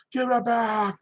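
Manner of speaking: high-pitched retold yell, not full volume; held-back imitation of screaming; mock scream. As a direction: imitate a man yelling, pitch pushed way up. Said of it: "the guy went up screaming".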